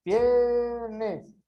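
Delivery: "piernas" with a long, held first syllable; stressed syllable drawn out. Shown as a demonstration. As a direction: stretch the start of "piernas", then drop on the end. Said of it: "ele teria o som mais forte".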